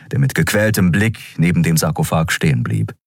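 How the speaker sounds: usual speaking voice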